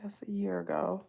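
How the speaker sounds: whispered to herself, trailing off. Real, not acted. Searching her phone, muttering under her breath in a whisper.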